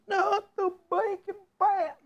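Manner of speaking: high squealing mock scream. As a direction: shriek it in playful despair. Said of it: "Everybody scream".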